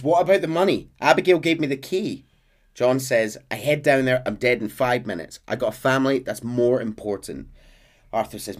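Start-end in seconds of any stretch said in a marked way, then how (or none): 0.0-2.2 higher, louder worried question; urgent Arthur. Retold or imitated. Arthur voiced in retelling. 3.5-7.5 louder, insistent refusal; firm John. Retold or imitated. John voiced in retelling.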